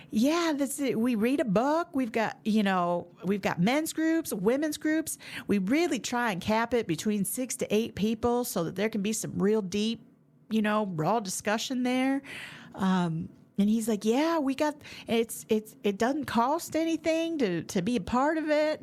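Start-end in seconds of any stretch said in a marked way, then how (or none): 0.1-12.2 higher, drawled Southern voice for Mark; hammed-up Southern gentleman voice; Southern drawl impression. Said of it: "terrible impression of Mark". 14.0-18.8 higher, drawled Southern voice for Mark; hammed-up Southern gentleman voice; Southern drawl impression. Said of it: "terrible impression of Mark".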